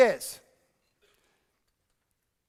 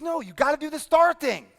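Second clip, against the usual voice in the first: insisting retort; 7 semitones higher, 7 dB louder than usual. Retold or imitated. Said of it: "I said".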